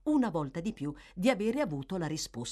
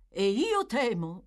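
firm, raised voice; emphatic; 5 semitones higher, 5 dB louder than usual. Acted. Gentleman adds his fear to the magistrate, emphatically. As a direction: say it with energetic insistence. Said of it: "soggiunse molto energicamente".

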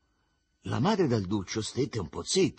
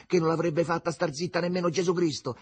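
plain reading voice

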